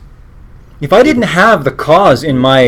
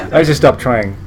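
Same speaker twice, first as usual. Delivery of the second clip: laughing at his own joke; real amused laughter; 6 semitones higher than usual. Real, not acted. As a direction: giggle through it.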